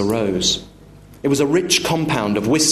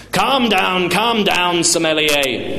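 raised-pitch condescending customer; overbearing father; 7 semitones higher, slower than usual. Acted. Father mock-soothes the offended waiter, overbearing. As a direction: talk down to the waiter.

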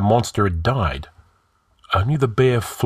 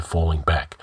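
plain reading voice

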